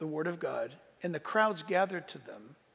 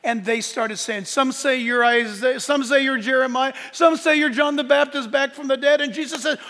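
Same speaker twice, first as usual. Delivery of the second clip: loud, raised retelling with building energy; preacher building momentum; full-force preaching; 7 semitones higher, 11 dB louder than usual. Acted. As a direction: stack up the answers in a projected pulpit voice.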